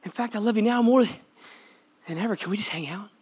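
soft, hushed reassurance; Jesus forgiving those who hurt him, softly; tender forgiving voice. Retold or imitated. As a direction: speak it quietly with love. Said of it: "And he's like".

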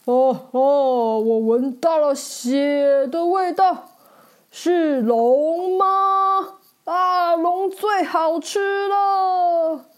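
slow, loud, menacing giant voice; booming giant. Acted. A giant sniffing out the dragon, booming slowly. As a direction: boom it out like a hungry giant. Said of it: "巨人用很大声音说".

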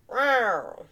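playful cat roar; mock roar. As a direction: roar like a big cat, just for fun. Roaring for the Jaguars.

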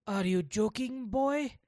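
put-on a teacher angrily scolding the boy; angry. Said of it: "he asked in anger".

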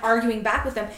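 ordinary talk

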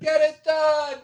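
high shrieking rally cry; shrieked cheer. Real, not acted. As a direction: scream it as a team chant. Cheering chant.

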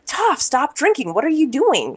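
high-pitched mock yell. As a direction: scold her in a high voice. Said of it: "and he yells at her".

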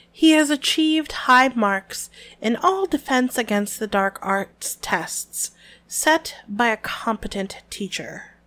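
slowing down on the final insult; slow burn. Shown as a demonstration. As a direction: drag out a competent teacher. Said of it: "the slow burn".

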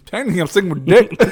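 fast, laughing through it. Real, not acted. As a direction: giggle out the answer. Laughing while answering quiz.

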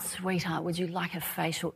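very soft, hushed offer; mother soothing her sick son, hushed; soft coaxing. Retold or imitated. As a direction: coax him gently like a nurse. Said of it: "I said".

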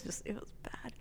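hushed whisper fading out; whispered, trailing off. Real, not acted. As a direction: drop to a whisper. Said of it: "whispered confession".

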